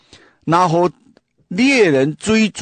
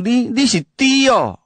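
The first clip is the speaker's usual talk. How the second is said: higher-pitched mock insult; insulto fingido; 9 semitones higher than usual. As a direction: act out calling someone a name. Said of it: "你骂人讲".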